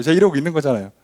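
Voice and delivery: ordinary talk, nothing marked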